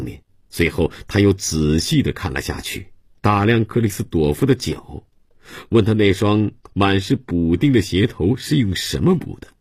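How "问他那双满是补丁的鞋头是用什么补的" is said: ordinary delivery, nothing marked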